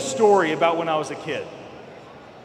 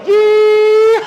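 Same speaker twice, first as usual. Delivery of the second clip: long, high, loud gleeful whoop; cowboy whoop; 12 semitones higher, 9 dB louder than usual. Retold or imitated. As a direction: hold it out like riding a bucking bull. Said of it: "he started like".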